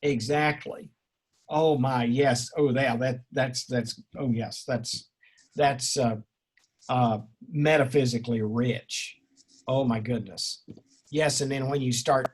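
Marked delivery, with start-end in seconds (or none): none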